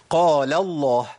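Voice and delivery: "قال الله" with a thick, dark l in "الله"; heavy lam. Shown as a demonstration. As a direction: after the open a, make the l of "الله" emphatic. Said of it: "فتح ما قبل لام لفظ الجلالة".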